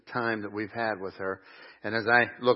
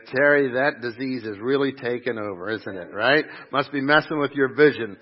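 teasing retort; 2 semitones higher, 7 dB louder than usual. Retold or imitated. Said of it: "I was like".

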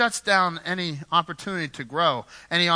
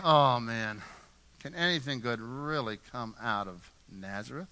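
lower, softer mock scoff; sneering doubt; 5 semitones lower, 5 dB quieter than usual. Nathanael scoffing at Nazareth, quiet sneer. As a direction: play the skeptic looking down his nose. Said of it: "He's rolling his eyes".

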